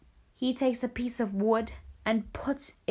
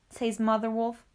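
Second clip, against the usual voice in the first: ordinary delivery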